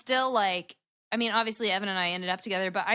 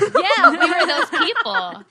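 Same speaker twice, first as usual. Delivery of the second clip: high, loud laughing yes; laughing agreement; 12 semitones higher, 11 dB louder, slower than usual. Real, not acted. Agreeing with the host's joke, high and loud through laughter. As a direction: crack up agreeing. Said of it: "old accent".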